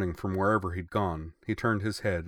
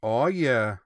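1 excited cheer; 5 semitones higher, 7 dB louder than usual. A narrator's reading. Eager fighter cheers agreement.

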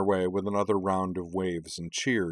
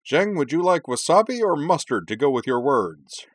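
high, loud on-air taunt; crowing broadcast banter; gloating sports announcer; 7 semitones higher, 6 dB louder than usual. A narrator's reading. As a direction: rub it in like a triumphant commentator. Commentator gloats to co-host on air.

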